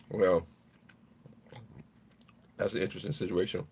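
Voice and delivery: usual speaking voice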